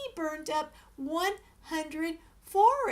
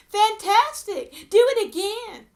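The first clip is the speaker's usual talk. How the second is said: shouted; 6 semitones higher, 11 dB louder than usual. A narrator's reading. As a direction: egg him on at full voice. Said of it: "but Elizabeth shouted".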